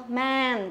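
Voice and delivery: "man" with a wide, open æ; mouth spread flat. Shown as a demonstration. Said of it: "đọc bẹt cái miệng ra".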